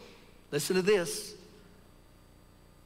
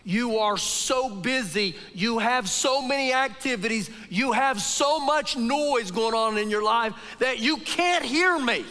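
usual speaking voice

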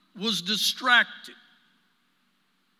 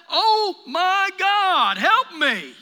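whiny plea; 7 semitones higher, 6 dB louder, much slower than usual. Retold or imitated. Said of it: "Are you not gonna tell her to".

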